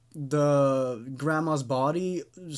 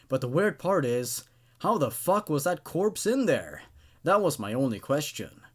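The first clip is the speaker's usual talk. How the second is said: plain reading voice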